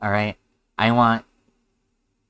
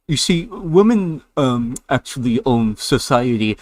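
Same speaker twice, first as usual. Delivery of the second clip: mocking dumb guy voice; 2 semitones higher, 4 dB louder than usual. Streamer mocking a video guy in a dopey voice. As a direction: parody the other guy as a clueless bro.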